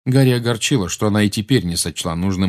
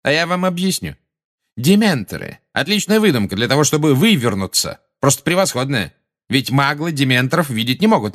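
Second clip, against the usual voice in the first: higher-pitched, self-satisfied mock explanation; smirking sarcasm; 7 semitones higher, 3 dB louder than usual. A narrator's reading. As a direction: gloat as you dismiss the story with a smile. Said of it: "Фадж по-прежнему улыбался".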